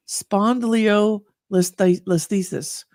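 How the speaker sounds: "spondylolisthesis" spelt out slowly, syllable by syllable; careful medical term. Shown as a demonstration. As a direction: sound out "spondylolisthesis" with care. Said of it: "Let's see if I can do this".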